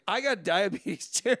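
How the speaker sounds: can't keep a straight face repeating it; laughing quote. Retold or imitated. Teller retelling the second man's excuse, laughing through it.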